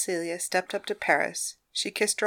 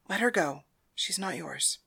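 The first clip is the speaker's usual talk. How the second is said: soft advice; 8 dB quieter than usual. Acted. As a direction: say it gently and quietly. Madam's soft final advice.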